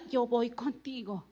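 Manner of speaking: low and soft, God reassuring; quiet divine assurance. Acted. The Lord to the waiting preacher, quiet assurance. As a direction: drop down into a quiet, low promise. The Lord's reply, voiced by preacher.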